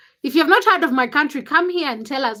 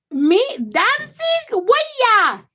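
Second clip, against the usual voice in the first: high, slow mock disbelief; incredulous; 10 semitones higher, 4 dB louder, much slower than usual. Real, not acted. Streamer answering chat about dancing, high disbelief. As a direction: act shocked at the very idea.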